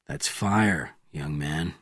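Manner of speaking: soft, low and calm; quiet, steady answer; softly. A narrator's reading. Bourne calmly answering the young Soviet, soft and low. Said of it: "answered Bourne softly".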